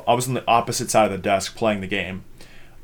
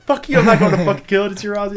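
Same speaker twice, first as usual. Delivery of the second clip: high, loud comic outburst; mock-outraged protest to a friend; indignant refusal; 10 semitones higher, 6 dB louder, faster than usual. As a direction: refuse hotly, replaying an argument. Reenacts refusal to kill cockroach.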